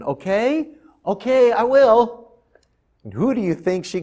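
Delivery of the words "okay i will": put-on high, slow, eager little girl; a bright girl promising to help. Miriam agrees to the princess, high eager girl.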